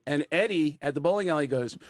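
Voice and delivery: ordinary delivery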